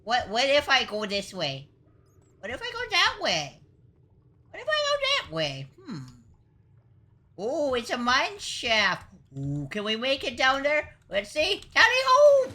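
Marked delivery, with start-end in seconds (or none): 2.4-5.7 very high squeaky cartoon voice; squeaky question. Pitch climbs on repeated question. 11.7-12.5 high, loud squeal of a charge; shrieked cartoon charge; gleeful battle cry. Charging down a mine shaft.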